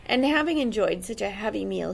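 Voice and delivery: ordinary delivery, nothing marked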